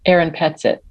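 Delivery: ordinary talk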